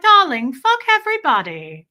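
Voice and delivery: put-on loud, high posh British accent; a grand British darling, loud and elegant. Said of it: "American accents don't pull that off".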